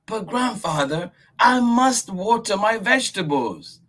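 put-on very high, pleading child voice; little girl voice. Miyuki pleading with grandfather. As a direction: beg to keep playing.

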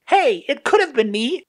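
very high-pitched put-on voice; indignant agent. Yellow objecting to being ruled out, high and indignant. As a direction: pipe up in protest, very high.

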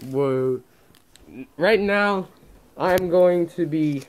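muffled behind cloth mask; speaking with mouth covered; through a face mask. Real, not acted.

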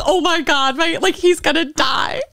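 high, squealing excitement; mock starstruck panic. Telling co-host her husband will meet Andy, mock starstruck squeal. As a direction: gush it in a high hammed-up wail.

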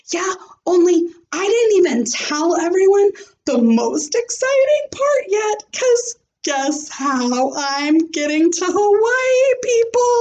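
high, fast and bubbling over; eager girl spilling big news; excited. Acted. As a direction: blurt it out like a kid who can't wait. Junie B bursting with news.

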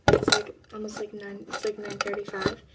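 normal talking voice

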